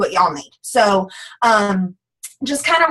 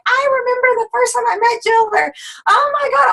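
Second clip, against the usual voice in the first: much higher, gushing mock excitement; excited fangirl imitation; 13 semitones higher than usual. People introducing her as that dancer, imitated with high-pitched excitement. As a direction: gush like a thrilled acquaintance.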